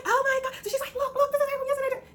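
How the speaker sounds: very high, delighted squeal; recognizing the fan from yesterday, thrilled; excited recognition. Retold or imitated. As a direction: gush with recognition. Celebrity recognizes her excitedly.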